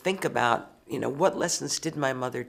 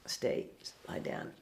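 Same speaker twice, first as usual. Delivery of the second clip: low, soft, slow order to a dog; soft aside to a pet; hushed command; 4 semitones lower, 8 dB quieter, slower than usual. Real, not acted. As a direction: quietly settle the dog mid-interview.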